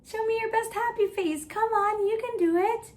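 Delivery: high, quick, eager encouragement; cheerful coaxing. Real, not acted. Coaxing children into happy faces.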